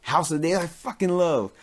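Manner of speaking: ordinary delivery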